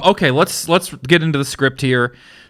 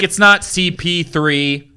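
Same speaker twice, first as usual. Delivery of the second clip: mock angry fans; 8 semitones higher, slower than usual. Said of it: "They were like".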